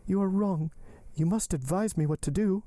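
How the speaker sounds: high-pitched heroine, insistent; urgent Valentine. Acted. Valentine urging Maximilian to advise her, insistent. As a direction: press him in a high voice.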